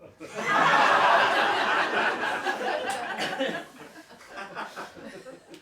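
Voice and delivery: put-on long breathy drunken laugh; wild drunken cackle. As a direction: cackle out of control. Drunk Mary laughing wildly.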